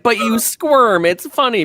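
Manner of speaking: high, drawn-out mock child protest; whining kids. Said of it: "They're like".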